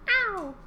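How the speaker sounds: high, falling meow; cat meow. Pretend. Answering the song's cue to children, high falling meow. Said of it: "say meow".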